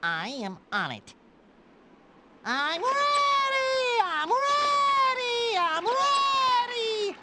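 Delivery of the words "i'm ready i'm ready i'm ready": high, slow wailing siren chant. Acted. Officer imitating a police siren in pursuit, high drawn-out wail. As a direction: stretch each cry like a siren.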